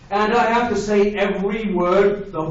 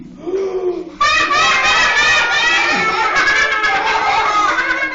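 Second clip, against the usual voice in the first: shocked gasp. Acted. Reader shocked by book's rule.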